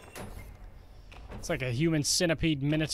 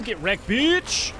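taunting; 5 semitones higher, 5 dB louder, much slower than usual. Real, not acted. Taunting a beaten enemy.

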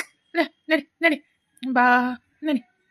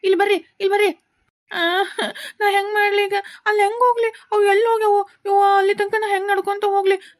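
calling the cows; 2 semitones higher, 4 dB louder than usual. Acted. Boy calls his runaway cows, loud and anxious. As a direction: shout after the runaway cows.

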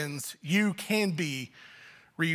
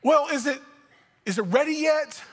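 put-on higher-pitched, pestering child; impatient kid; 7 semitones higher than usual. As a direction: pester a parent like a waiting kid. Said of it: "they were like".

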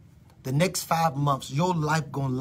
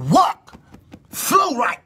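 high, loud prophetic command; commanding cry; 11 semitones higher, 7 dB louder, much slower than usual. Real, not acted. Preacher commanding the body loudly. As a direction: call out each word like a decree.

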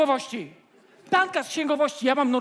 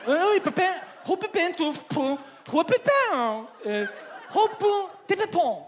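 mock French gibberish; 4 semitones higher than usual. Fake French gibberish on phone.

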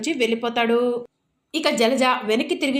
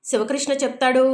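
ordinary delivery, nothing marked

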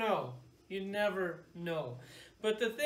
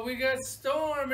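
imitated panel announcement, slow and raised; announcing presenters; 4 semitones higher, 6 dB louder, slower than usual. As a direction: present it like a big reveal. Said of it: "And then they go".

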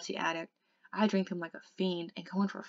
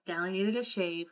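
ordinary delivery, nothing marked

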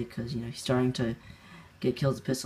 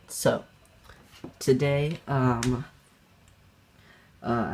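normal talking voice

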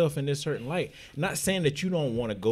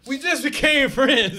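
laughing; 6 dB louder, slower than usual. Real, not acted. Laughing retort.

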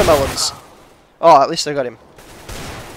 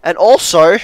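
usual speaking voice

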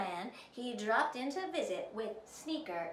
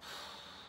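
unhappy sigh; 11 dB quieter than usual. A narrator's reading. Prince unhappy, no calls.

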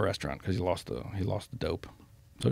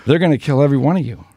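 laughing threat; 5 semitones higher, 8 dB louder, faster than usual. Real, not acted. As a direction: burst of loud laughter, then the threat rushed out fast and louder. Laughs while retelling the threat.

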